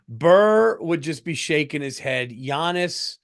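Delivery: usual speaking voice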